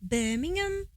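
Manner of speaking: high Brummie lilt; Brummie accent. Shown as a demonstration. Demonstrating Brummie to partner, high Brummie accent. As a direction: say it like a Birmingham local. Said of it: "What's Brummie again?".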